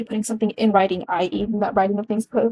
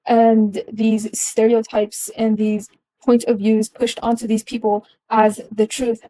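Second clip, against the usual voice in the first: ordinary delivery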